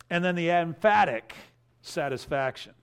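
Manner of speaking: ordinary talk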